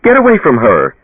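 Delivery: high, loud screaming insult; angry scream. A narrator's reading. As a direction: scream him off your daughter. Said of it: "Her mother screamed".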